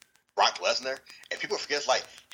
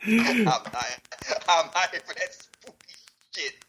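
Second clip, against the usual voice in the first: raised, high and punchy; loud wrestling promo; 7 semitones higher, 4 dB louder than usual. Retold or imitated. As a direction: bark it like a heel promo. Brock voiced, louder.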